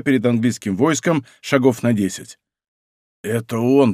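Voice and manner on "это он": usual speaking voice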